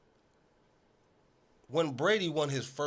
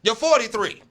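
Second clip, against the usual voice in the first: louder, higher jab at his age; mocking yell; 6 semitones higher, 8 dB louder than usual. Host heckling Brady about his age, a loud mocking yell. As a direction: call him out loudly like a heckler.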